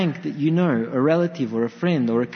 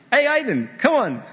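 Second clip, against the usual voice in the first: higher, louder call across the pool; 7 semitones higher, 4 dB louder than usual. Retold or imitated. Father calling his son to jump in, bright call. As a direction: cheerfully call the boy to jump.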